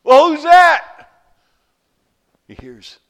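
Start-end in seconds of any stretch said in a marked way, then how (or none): 0.0-1.1 slow, loud, high shout upward; calling up. Acted. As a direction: holler up the cliff in disbelief. Hanging man calling up.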